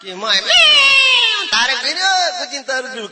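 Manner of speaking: high, loud panicked cry; panicked shout. Acted. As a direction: call out in comic alarm. Panicked calling out.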